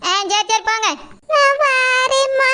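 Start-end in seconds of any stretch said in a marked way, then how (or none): 1.3-2.5 put-on high, slow old woman's voice, prying and nosy; old mother-in-law. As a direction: drag out each word like a curious granny.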